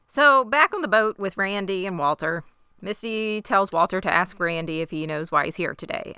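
normal talking voice

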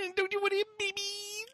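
very high, fast falsetto; mock baby-begging in a tiny high voice; squeaky mock pleading. Acting out line from show.

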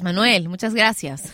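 usual speaking voice

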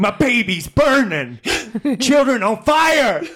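high, loud, drawn-out anguished wail; mock-tragic slam poetry howl; Casey Affleck character. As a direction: cry it out loud and slow, hammed up. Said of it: "go as the Casey Affleck character from Manchester".

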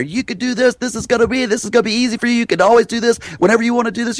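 energetic encouragement. Retold or imitated. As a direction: rattle off encouragement to each one. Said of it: "I just go at 'em one after another".

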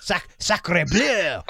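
very slow, hammed-up French accent; fake French accent. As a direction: drag out sacre bleu in a cartoon French accent. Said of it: "like every other accent".

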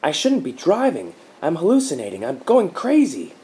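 high, loud, frantic cries; spiralling into panic, slowing on crazy; loud, high panic of a man losing his grip; panicked outburst. A narrator's reading. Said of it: "Mark blurted".

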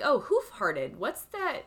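quoting herself puzzled over the beer name; mock confusion. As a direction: play your past self not getting the joke. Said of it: "I was like".

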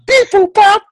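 high mock computer beeps; robot beeps. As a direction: beep it out like a machine. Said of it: "Geordi's mom read is".

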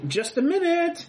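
high call from above; distant call. Acted. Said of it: "you hear someone say".

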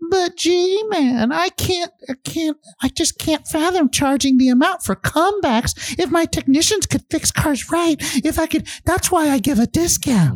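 squeaky caricature of a pleading owner; mock shop owner voice. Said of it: "I'm gonna do the voice again for you".

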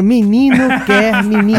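slow, low sultry radio voice; voz sensual de rádio. Retold or imitated. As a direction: croon it like a late-night DJ. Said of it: "um cara com uma voz bem sensual". (voz grave e sensual de locutor)

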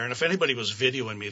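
ordinary talk, nothing marked

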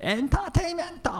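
high-pitched mock announcer call; big announcer shout. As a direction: belt out the show title. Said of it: "とか言って".

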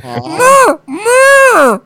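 panicked crying call. Pretend. Waking with jaw wired shut.

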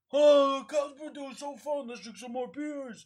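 high, loud party cheer that settles; drunk cheer. Retold or imitated. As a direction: whoop like the night is still young. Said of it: "We're like".

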